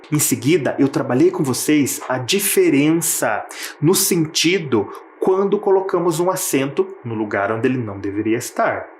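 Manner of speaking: ordinary talk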